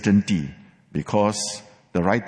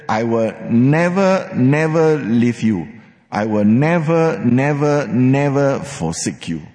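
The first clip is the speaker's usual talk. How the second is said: each "never" punched, louder and building; emphatic nevers; 3 semitones higher, 4 dB louder than usual. Acted. Preacher rendering God's fivefold promise to believers, louder insistent nevers. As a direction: hammer every "never" like a vow. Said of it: "this promise is, uh, super emphatic".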